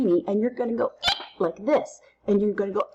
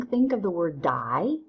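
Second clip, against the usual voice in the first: ordinary talk, nothing marked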